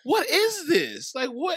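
high, incredulous retold disbelief; bewildered. Cousin retelling his reaction to the tantrum, high baffled disbelief.

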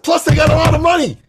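very high, loud and fast; eager friend blurting his motive; excited Dan. Retold or imitated. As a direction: gush about the money. Quoting Dan's money idea.

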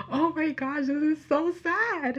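high, slow whimpering "so sad"; mock weepy wail. Fan retelling crying at Lyra's speech, high weepy wail. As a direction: wail it like you're about to cry.

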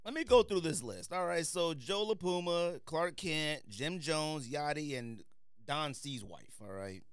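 ordinary delivery

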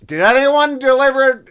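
high, loud lost delivery guy; bewildered guy walking into the wrong room; regular delivery guy. Retold or imitated. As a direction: call out like a confused delivery man. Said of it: "he's like".

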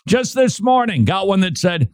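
ordinary talk

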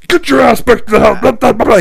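high, loud mock yelling; hammed-up roaring scold that tumbles into gibberish; furious dad. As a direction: imitate dad shouting to chase a kid home. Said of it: "He's chasing me back to the house".

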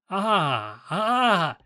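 put-on high, cackling taunt of an old witch; mocking witch. A narrator's reading. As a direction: gloat over the prince's loss. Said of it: "cried mockingly".

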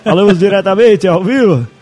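Galvão Bueno impression. Podcaster parodying the match opening for laughs, announcer impression. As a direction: open the broadcast like a booming football announcer. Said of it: "começa a narrar igual o Galvão Bueno".

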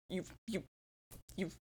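ordinary talk, nothing marked

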